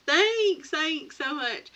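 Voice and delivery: high, loud, then slowing thanks; excited thanks. Real, not acted. Thanking viewers for kind comments, high and eager. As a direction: gush a thank-you at the chat.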